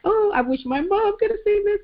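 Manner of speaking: high-pitched reenacted wish; retold wistful exclamation. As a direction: act out your own wishful cry, pitch way up. Acts out her wistful exclamation.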